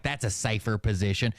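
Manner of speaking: ordinary talk, nothing marked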